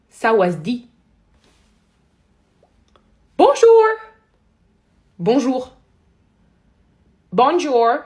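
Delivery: very loud, punched-out greetings; cris de jeu. Real, not acted. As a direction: shout each word at the game. Shouting greetings at a game. (crier très fort, mot par mot)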